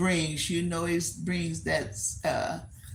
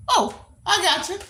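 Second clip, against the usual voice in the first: laughing; 12 semitones higher, 4 dB louder than usual. Real, not acted. Laughing realization.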